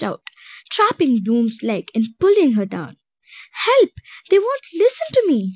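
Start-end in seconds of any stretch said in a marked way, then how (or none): 3.7-5.5 higher, louder, desperate cry; frustrated cry for help. A narrator's reading. Bloom pulled down by vines, crying out in frustration. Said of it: "she cried in frustration".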